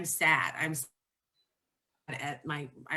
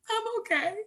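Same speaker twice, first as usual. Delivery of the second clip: much higher, squeaky fake-okay voice; high strained insistence through tears; forced "I'm okay"; faster than usual. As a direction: pretend you're fine while crying. Mom insisting while in tears.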